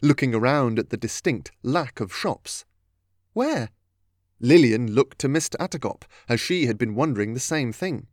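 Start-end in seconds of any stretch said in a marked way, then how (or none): 3.3-3.7 high-pitched, baffled query; puzzled question. A narrator's reading. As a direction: ask it in open puzzlement. Catherine puzzled by lack of shops.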